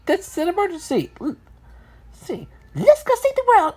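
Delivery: high, urgent exclamation; rally them in a very high character voice; exclaiming. A narrator's reading. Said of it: "exclaimed one".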